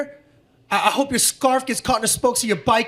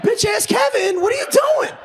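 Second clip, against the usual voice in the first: exasperated yell; 10 semitones higher than usual. Retold or imitated. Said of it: "I'm like".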